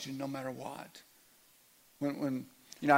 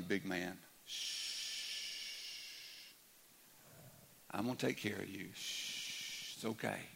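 put-on low, soft comforting of a child; soothing hush; 4 semitones lower, 7 dB quieter, slower than usual. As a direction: shush gently and reassure, as if holding them close. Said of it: "pull them close to you and go".

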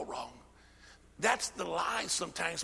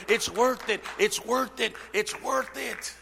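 preaching refrain; 3 semitones higher, 5 dB louder, slower than usual. Real, not acted.

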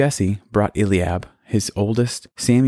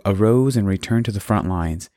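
plain reading voice

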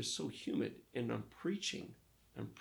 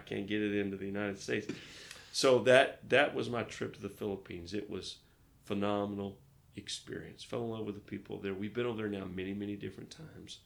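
ordinary talk, nothing marked

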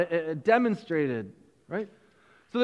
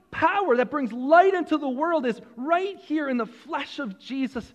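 higher, fervent preaching; preaching at full force; 7 semitones higher than usual. Acted. Preacher proclaiming Jesus as the creative Word, fervent. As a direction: proclaim it with fervour.

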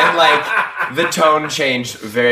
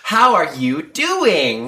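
put-on very slow, higher kids-show question; Dora the Explorer; 5 semitones higher, much slower than usual. Host asking the audience, mock Dora voice. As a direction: stretch each word for imagined preschool viewers. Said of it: "It's like Dora the Explorer".

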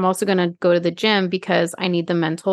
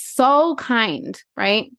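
usual speaking voice

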